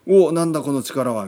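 loud, startled anime hero; shocked power-up. Retold or imitated. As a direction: bellow it as if power surges. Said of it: "みたいな".

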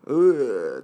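loud drawn-out zombie moan; zombie groan. Retold or imitated. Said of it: "とか言いながらね".

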